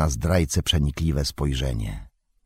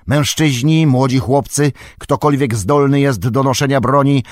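louder, higher royal command ringing out; commanding king; 6 semitones higher, 5 dB louder than usual. A narrator's reading. King Theoden ordering the muster, loud and commanding. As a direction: rouse the city to arms.

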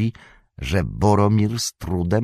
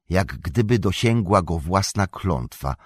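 plain reading voice

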